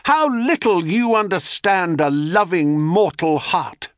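high, declamatory call; theatrical reproach called aloud; crying out. A narrator's reading. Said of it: "they would cry".

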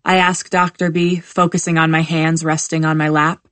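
ordinary delivery, nothing marked

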